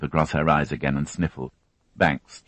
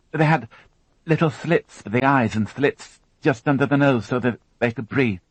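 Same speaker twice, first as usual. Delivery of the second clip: put-on higher, tense young woman recounting an ordeal; higher, strained witness voice; Alison, shaken young witness; 8 semitones higher than usual. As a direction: voice her as a frightened girl holding it together. Alison describes attackers.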